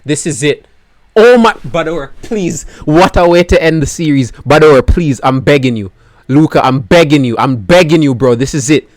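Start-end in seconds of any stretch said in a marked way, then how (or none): 4.3-8.3 loud pleading. Real, not acted. Pleading with the driver.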